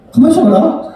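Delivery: high-pitched, acted-out forgetful child; puzzled child. Child forgets errand mid-greetings. (어리둥절한 아이 흉내, 높은 목소리로)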